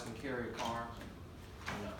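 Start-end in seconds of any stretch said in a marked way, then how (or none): none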